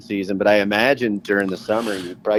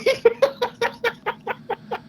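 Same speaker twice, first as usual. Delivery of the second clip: very high, giddy giggle; laughing; 3 dB quieter than usual. Real, not acted. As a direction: burst into a long, high-pitched laugh. Laughs after excited agreement.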